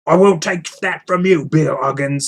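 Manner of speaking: a troll taking offence; angry troll. Acted. Troll quarrel.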